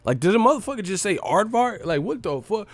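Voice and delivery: can't believe he said aardvark; high disbelief. Real, not acted. Reacting to a rapper saying aardvark, high and baffled.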